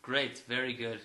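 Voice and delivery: touch of a serial killer on air; serial killer radio voice. Real, not acted. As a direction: put on a mild radio voice with a creepy edge. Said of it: "You sound like a serial killer".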